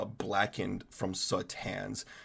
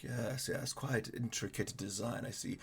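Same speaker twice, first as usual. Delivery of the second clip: soft, murmured appraisal; quiet musing; 8 dB quieter than usual. Acted. Dwarf smith quietly examining the axe's runes. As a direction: mutter it while studying the runes. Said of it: "he's like".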